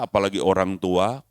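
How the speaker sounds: usual speaking voice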